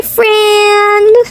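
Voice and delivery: plain reading voice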